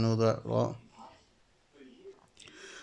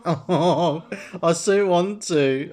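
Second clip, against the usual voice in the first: slow, higher, louder through laughter; laughing; 5 semitones higher, 5 dB louder, much slower than usual. Real, not acted. Giggling at a cheeky answer option, laughing. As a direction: barely get the words out for laughing. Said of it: "I'm laughing my ass off".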